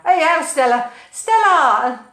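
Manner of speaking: big, loud, playful hello; calling out a greeting. Real, not acted. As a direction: call the name out with gusto. Calling out to arriving viewer.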